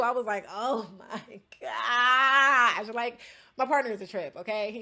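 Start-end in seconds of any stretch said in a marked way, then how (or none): 0.5-2.9 very slow, drawn-out mock despair; exasperated groan. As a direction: stretch it out like you're done with him. Exasperated at partner.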